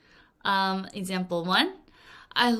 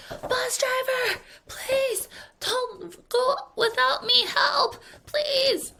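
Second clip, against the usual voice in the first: much higher, slow pleading call; mock pleading holler; much slower than usual. Kid left behind calling to the bus driver, high pleading. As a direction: beg the bus driver to stop. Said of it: "And you're hollering".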